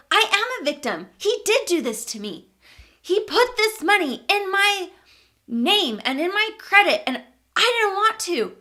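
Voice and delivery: high, loud, insistent protest; angry self-justifying. Retold or imitated. Arguing to her sister that he did this, angry. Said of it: "And I was just so angry".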